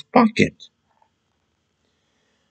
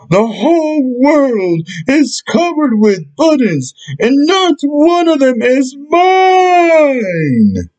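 screaming; 13 semitones higher than usual. Acted. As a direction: throw a tantrum at the whole world.

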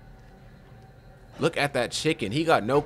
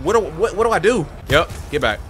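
ordinary talk

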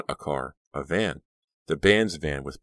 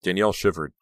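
usual speaking voice

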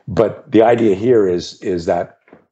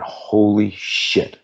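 stunned realization; 4 semitones higher, much slower than usual. Retold or imitated. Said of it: "I went".

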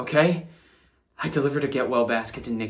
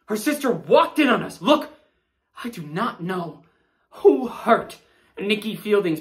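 panicked pleading; 7 semitones higher than usual. Acted. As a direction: beg them to believe you. Suspect pleading innocence, panicking.

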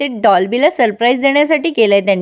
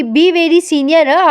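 high, very slow "be very senior हं"; over-slow mock-stern English; comic slow lecture; 5 semitones higher, much slower than usual. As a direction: drag it out like a mock serious warning. Pinky's comic broken English.

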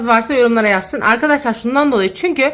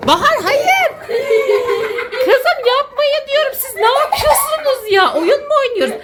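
exasperated protest; 12 semitones higher than usual. Real, not acted. Mother begs giggling children to stop, exasperated high protest. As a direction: protest helplessly at the top of your range.

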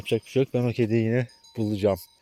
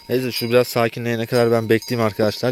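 normal talking voice

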